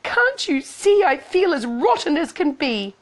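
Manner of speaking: high, whining complaint; high-pitched self-pitying outburst; petulant Cindy. Acted. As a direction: wail it like a hard-done-by girl.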